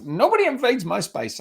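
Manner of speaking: a bold little pup guarding its space, in a high cartoon voice; Scrappy-Doo voice. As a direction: squeak it like a tiny cartoon tough guy. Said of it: "on Scooby-Doo where there's a little Schnappy-Doo".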